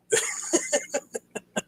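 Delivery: soft, high wheezy chuckle; helpless quiet giggle; laugh. Real, not acted. Admitting to viewers the joke gets corny, laughing.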